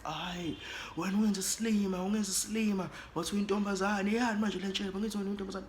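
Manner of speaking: imitating a man outraged at being fooled; mocking accusation. Man accusing narrator of deceiving him.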